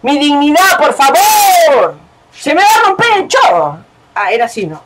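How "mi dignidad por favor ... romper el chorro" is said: high, stretched wail; distressed protest. Real, not acted. Player losing dignity during exercise, distressed wail. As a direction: wail for mercy, dragging out the ends.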